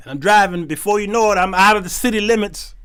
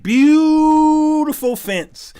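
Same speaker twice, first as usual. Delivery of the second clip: drawn-out, higher admiring sigh; awed; 9 semitones higher, 3 dB louder, much slower than usual. Real, not acted. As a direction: savor the word in awe. Awed at the fence.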